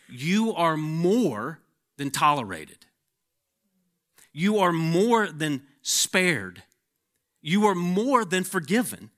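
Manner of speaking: preacher lifting the congregation up; preaching. Acted. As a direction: swell into a triumphant pulpit cry. Rising declaration to believers.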